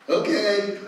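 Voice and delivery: mildly cocky acted reply. Cessationist brushing off the missionary, cocky. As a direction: imitate a smug brush-off, lightly. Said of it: "Like, kinda cocky".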